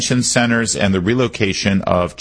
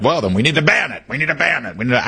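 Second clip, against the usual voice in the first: higher, faster mock voice of a Democrat; 7 semitones higher, faster than usual. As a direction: parody a knee-jerk politician, higher and fast. Mocking a Democrat's knee-jerk reaction.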